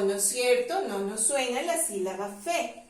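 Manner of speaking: ordinary talk, nothing marked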